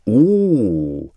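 a loud excited "Oh"; surprised courtiers. Acted. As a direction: gasp in delight at the noise. Courtiers hear the cow.

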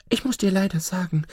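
lightly voiced crafty fox giving orders; sly fox. Fox telling the wolf how to stand, lightly sly. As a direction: instruct him smoothly.

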